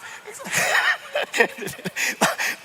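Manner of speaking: big, high burst of laughter. Real, not acted. Joke about sandpaper.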